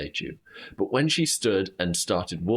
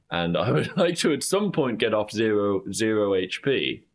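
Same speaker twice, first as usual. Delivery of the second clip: laughing; 3 semitones higher than usual. Real, not acted.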